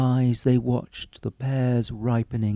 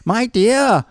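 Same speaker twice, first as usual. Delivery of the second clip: high, loud cry; husband's voice; 10 semitones higher, 5 dB louder than usual. A narrator's reading. Husband crying to his wife, mouth full. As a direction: call out through a full mouth. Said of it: "cried the husband ... so full".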